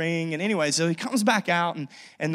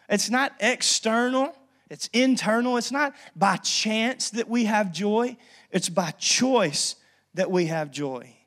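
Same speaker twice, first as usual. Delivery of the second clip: preaching; 5 semitones higher, slower than usual. Acted. Preaching points.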